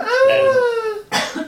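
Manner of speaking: very high, loud pained wail; cry of pain. Acted. Player acting out the dislocated shoulder, high pained cry. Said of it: "cry out in pain".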